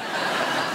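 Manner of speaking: short breathy laugh at his own story. Real, not acted. Amused laugh telling the host how they met. Laughing about wife loving food.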